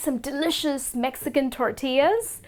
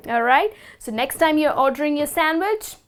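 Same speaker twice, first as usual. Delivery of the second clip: ordinary talk, nothing marked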